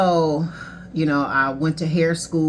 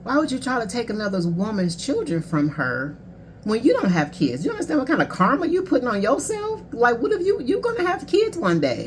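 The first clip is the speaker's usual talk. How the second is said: higher, building indignant lecture, retold; indignant tirade; 6 semitones higher than usual. To the ex's new wife about taking her children, indignant and much higher. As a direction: scold her as if she stood in front of you. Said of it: "And I'm like".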